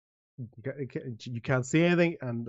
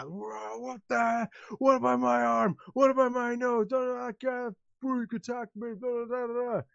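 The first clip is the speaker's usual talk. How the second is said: much higher, protesting, blustering; outraged injured worker; 11 semitones higher than usual. Acted. Injured worker protesting the mayor's verdict, outraged. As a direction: whine and sputter in outrage.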